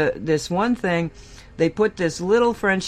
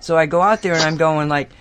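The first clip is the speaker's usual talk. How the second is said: usual speaking voice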